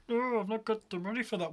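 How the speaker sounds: high, dumb protester excuse; idiot voice. Retold or imitated. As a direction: say it high and dim.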